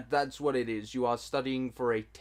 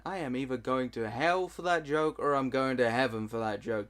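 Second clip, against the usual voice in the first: ordinary talk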